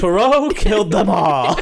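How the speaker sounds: loud, high, hammed-up exclamation; mock-dramatic reveal. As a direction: cry it out like a shock twist. Joke mock-dramatic spoiler.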